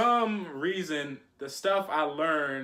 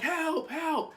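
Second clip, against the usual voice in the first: mock cry for help; 13 semitones higher than usual. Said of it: "And I'm screaming".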